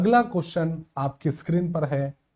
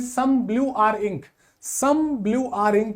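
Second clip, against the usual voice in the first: very slow, word by word, reading out the statement; slow dictation; 3 semitones higher, much slower than usual. Shown as a demonstration. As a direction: read it slowly so the class can copy it. Teacher reads statement aloud. (धीरे-धीरे बोलकर लिखवाना)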